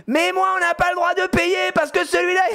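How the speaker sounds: put-on high, wailing child tantrum; put-on high-pitched yelling; shouting little girl. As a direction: mimic a kid screaming her argument. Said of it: "elle se met à crier".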